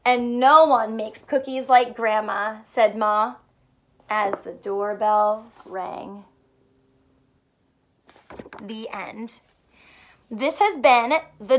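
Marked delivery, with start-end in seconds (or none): 0.1-9.4 lively picture book reading; story read to children. Acted. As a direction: read it like storytime for little ones. Reads picture book aloud.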